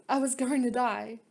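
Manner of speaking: ordinary talk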